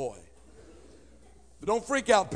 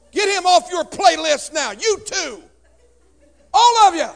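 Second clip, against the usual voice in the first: much higher, louder fiery scold; scolding shout; 10 semitones higher, 14 dB louder than usual. Acted. Comedian scolds the church audience, fiery shout. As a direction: blast the crowd like an outraged preacher.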